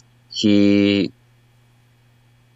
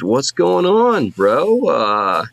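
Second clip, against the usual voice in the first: higher, slow, eager little brother; Sly's voice; 7 semitones higher than usual. Acted. GM voicing Sly greeting his brother, higher and slow. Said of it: "He says".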